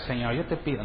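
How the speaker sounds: soft murmured prayer, hushed and meek; quiet prayer. Shown as a demonstration. Preacher shows how clamoring should not sound, quiet pleading. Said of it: "Claman, pero no, no así".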